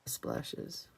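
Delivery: a soft breathy whisper of the word Splashes. Shown as a demonstration. Crafter murmuring to herself over her card, whispered. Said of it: "I just want some splashes".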